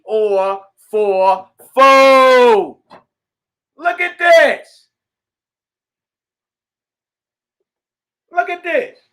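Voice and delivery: high, loud preacher's shout; preaching shout. Real, not acted. Preacher shouting the doctrine at his listeners, full force. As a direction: cry it out to the congregation, full force.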